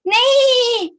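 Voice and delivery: a high, loud whinnying neigh; horse neigh. Pretend. Teacher showing kids a horse, loud high neigh.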